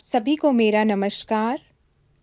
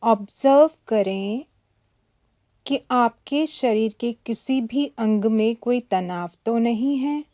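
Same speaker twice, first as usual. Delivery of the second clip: guided meditation. Acted. Yoga teacher guides listeners to relax body and breath, calm meditation voice.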